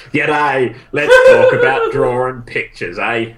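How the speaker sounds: laughing, hammed-up Australian accent; jokey broad Australian accent; exaggerated Australian accent. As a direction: play up the Aussie accent while cracking up. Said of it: "mine comes along like".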